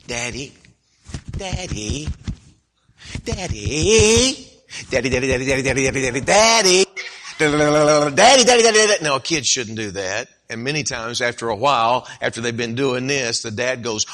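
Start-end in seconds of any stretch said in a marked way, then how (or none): 0.1-9.0 put-on pestering child. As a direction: nag daddy louder and louder. Child pesters busy father.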